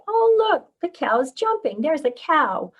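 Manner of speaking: put-on high, loud pointing-out to a toddler; child-directed exclamation, starting very high; excited parent. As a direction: delight a small child with what you spotted. Parent showing child a cow.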